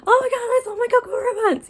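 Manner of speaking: squealing future self. Pretend. Vlogger imagining her future self, squealing and laughing with glee. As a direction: gush like a giddy fan looking back. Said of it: "look back on this and be like".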